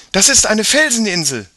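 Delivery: high, loud call across the wind. A narrator's reading. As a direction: shout out the discovery. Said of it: "rief Mia".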